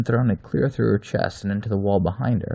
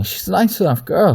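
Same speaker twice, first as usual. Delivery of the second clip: high, loud desperate pleading; 12 semitones higher, 9 dB louder than usual. Acted. Terrified Aver begs the wolf to spare the woman. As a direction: beg for her life. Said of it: "with a pleading tone".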